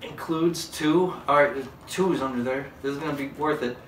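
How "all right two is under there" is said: ordinary delivery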